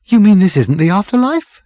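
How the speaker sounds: high, loud, startled question; astonished. Acted. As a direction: blurt it out in shock. Characters astonished at restaurant.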